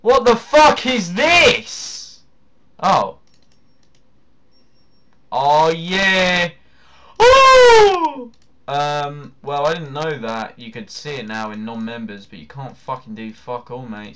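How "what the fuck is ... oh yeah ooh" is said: high, loud, drawn-out surprise; surprised exclamation. Real, not acted. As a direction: sound astonished, up high. Surprised at item.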